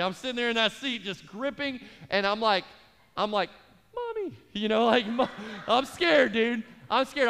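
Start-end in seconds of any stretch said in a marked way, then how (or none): 3.9-4.3 put-on much higher, squeaky childlike call; mock cry for mommy. Terrified on takeoff, calls for mom. 5.1-7.3 louder, higher hammed-up fear; mock scared whimper. Terrified on bumpy takeoff.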